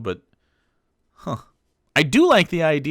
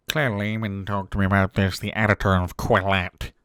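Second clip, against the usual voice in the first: put-on affected voice echoing the speaker; exaggerated parody of someone's diction; mocking imitation; 3 semitones lower than usual. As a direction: mimic a stilted, self-important talker. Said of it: "who would talk like this".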